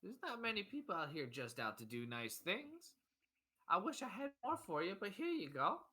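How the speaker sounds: high, earnest character voice; humble, high-voiced country dad; grateful father. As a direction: thank them warmly, pitch lifted. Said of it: "He goes".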